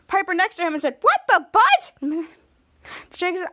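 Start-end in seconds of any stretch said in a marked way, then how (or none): 1.0-1.9 high, loud mock outburst; acted shock. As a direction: exclaim in hammed-up alarm, voice shooting up. Acts shock at exploded sink.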